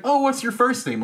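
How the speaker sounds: high put-on questioning voice; curious questioner. Stranger asking about the name tag, high mock curiosity. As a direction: pitch it way up as a nosy stranger.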